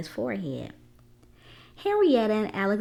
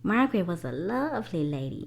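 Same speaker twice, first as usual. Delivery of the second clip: plain reading voice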